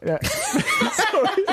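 high-pitched laughing sorry; laughing apology. Real, not acted. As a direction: squeak out sorry while laughing.

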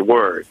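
"word" with an American R. Shown as a demonstration. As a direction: sound the R in "word" American-style. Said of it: "can't even recognise an American R".

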